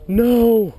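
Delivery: high gasp then loud cry of no; gasp into a shout. Real, not acted. Angler cries out at a lost fish, shocked. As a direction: gasp and wail as the fish drops.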